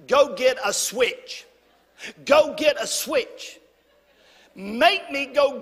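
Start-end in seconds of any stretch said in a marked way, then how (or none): 0.1-3.6 slow, firm mama order; stern slow mother's command; mama's command. Retold or imitated. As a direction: lay down the law like a Southern mom. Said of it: "she would say things like this".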